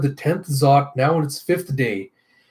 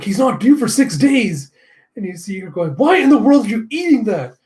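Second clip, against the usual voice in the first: shocked outburst; 8 semitones higher, 4 dB louder than usual. Acted. Shocked at Pam eating flan.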